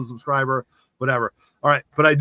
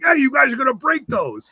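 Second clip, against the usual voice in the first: higher-pitched teacher's scolding call; teacher telling kids off, pitched up; 9 semitones higher than usual. Retold or imitated. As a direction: warn them like a teacher catching kids. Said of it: "in my teacher voice".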